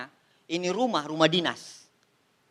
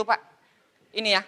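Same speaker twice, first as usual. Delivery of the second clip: normal talking voice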